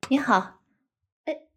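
ordinary delivery

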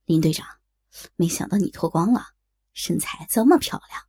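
low-pitched lecherous male voice; leering teasing. Acted. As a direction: tease with a sleazy, low voice. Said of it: "高斌调笑道".

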